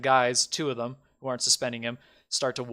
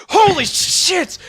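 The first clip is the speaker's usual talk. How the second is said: high, loud, slow yell of shock; startled shout; 11 dB louder, much slower than usual. Acted. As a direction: blurt it out in fright. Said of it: "And they're like".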